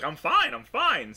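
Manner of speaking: mock reassurance. Game master imitates Ren waving off Lola, high and insistent. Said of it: "Ren's trying to just be like".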